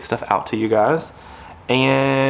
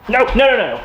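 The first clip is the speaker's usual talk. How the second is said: much higher, louder rapid nos; alarmed no; 12 semitones higher, 6 dB louder than usual. Real, not acted. Owner stopping puppy from chewing, alarmed high nos. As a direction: stop the puppy in a panic. Puppy grabs something forbidden.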